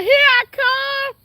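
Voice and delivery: high, drawn-out retold "on my way" holler; mock calling through the house, stretched and high. Woman retelling how she called out from the house, high drawn-out call. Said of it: "I'm in the house talking".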